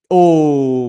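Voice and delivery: high, loud startled exclamation; sudden surprised oh. Real, not acted. Commentator seeing Muzza go big, loud startled oh. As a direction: gasp out the surprise.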